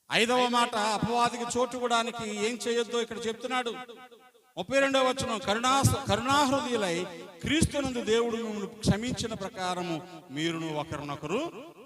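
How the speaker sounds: booming pulpit reading of the Bible; preaching at full force. Acted. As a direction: declaim the verse to the whole church. Reads verse at full force.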